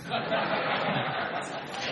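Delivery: soft breathy laugh; quiet chuckle at the joke; short hushed laugh. Real, not acted.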